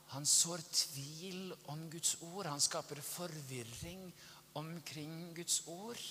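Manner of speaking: slow, soft, drawn-out words; insinuating hush. Shown as a demonstration. Preacher showing how the devil sows doubt, insinuating hush. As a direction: stretch it out softly to sow doubt. Illustrates the devil sowing confusion.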